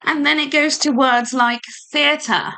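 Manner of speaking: ordinary talk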